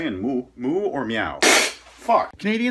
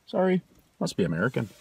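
normal talking voice

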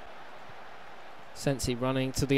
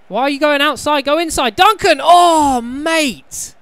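high, loud exasperated yelling at Duncan; exasperated shout; 11 semitones higher, 12 dB louder than usual. Real, not acted. Gamer yelling at his player Duncan, furious. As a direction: yell at the player in frustration, much higher and louder.

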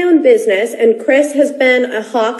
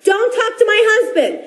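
higher-pitched mock scolding; retold angry warning; 7 semitones higher than usual. Fan retelling how she defended her husband, raised mock yell. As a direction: replay the shout, half in fun. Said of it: "And I go".

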